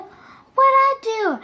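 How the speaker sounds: high, drawn-out protest; mock bewildered auntie. Auntie minding her own business gets yelled at, mock bewilderment. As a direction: imitate an innocent bystander whining. Auntie wrongly caught in quarrel.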